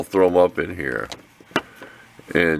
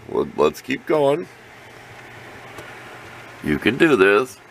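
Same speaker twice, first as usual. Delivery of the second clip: kindergarten teacher voice; 7 semitones higher than usual. Acted. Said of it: "Sound like a kindergarten teacher".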